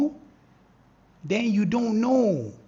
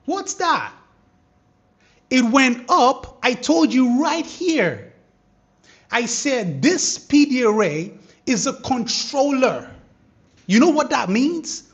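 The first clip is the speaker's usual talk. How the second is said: louder, higher, hyped explaining; excited; 5 semitones higher, 8 dB louder than usual. Real, not acted. Trader explaining the controller to viewers, excited. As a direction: keep the hype while you explain.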